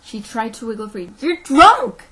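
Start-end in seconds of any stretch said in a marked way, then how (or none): 1.2-2.0 high, very loud outburst; indignant yell. A narrator's reading. Teacher struggles free of the sheriff, yelling. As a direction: yell it in outrage. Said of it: "she yelled".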